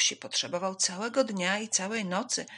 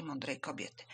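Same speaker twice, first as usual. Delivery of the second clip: usual speaking voice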